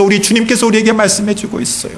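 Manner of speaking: normal talking voice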